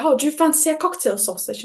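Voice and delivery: put-on British accent. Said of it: "you sounded very British right then".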